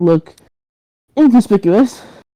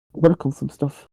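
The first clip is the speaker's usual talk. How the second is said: ordinary delivery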